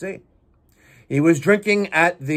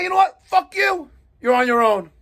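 angry retort; 6 semitones higher than usual. Pretend. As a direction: fire it back as a shouted brush-off. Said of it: "I'm gonna say".

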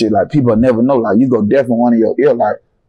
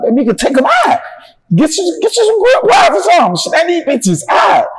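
desperate plea. Retold or imitated. Begging nurse, desperate.

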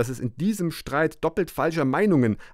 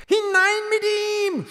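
mob shouting. A narrator's reading. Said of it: "schrie die Menge".

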